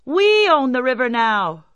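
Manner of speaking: high, insistent claim; indignant cry. Acted. Rich men claiming the river, high indignant cry. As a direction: call it out high and proud of ownership. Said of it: "they cried".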